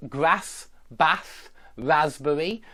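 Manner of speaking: northern English short a in grass, bath, raspberry; flat northern English a, slowly; northern English accent. Shown as a demonstration. Teacher imitating the northern English vowels. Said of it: "the northern accent will very often pronounce".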